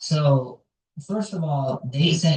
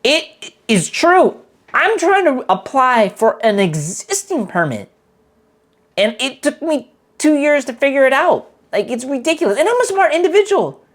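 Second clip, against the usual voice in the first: frustrated; 10 semitones higher, 7 dB louder than usual. Real, not acted. Frustrated rant opening.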